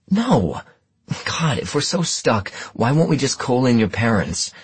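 high-pitched, exasperated protest; exasperated outburst. A narrator's reading. Partner arguing with detective over being stuck, exasperated. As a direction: burst out in frustration, pitch leaping. Acted quarrel between the partners.

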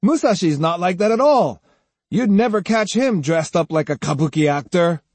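indignant, scornful protest; disdainful cry. A narrator's reading. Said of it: "Jotaro cried disdainfully".

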